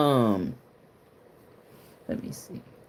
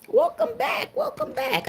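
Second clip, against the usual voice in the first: way up high, welcoming them back; excited high greeting; 5 dB quieter than usual. Real, not acted. Streamer greeting returning viewers, excited and high. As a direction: squeal a happy welcome back.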